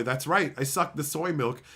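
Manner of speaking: usual speaking voice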